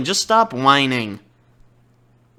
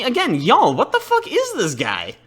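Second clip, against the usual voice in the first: normal talking voice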